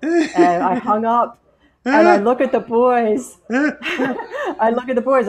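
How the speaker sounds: giggling through the memory; laughing. Real, not acted. Telling friends the funny call, laughing.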